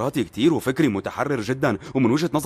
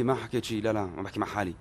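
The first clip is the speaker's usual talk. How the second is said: quiet backpedal; 6 semitones lower, 8 dB quieter than usual. Acted. Covering up an aside on phone.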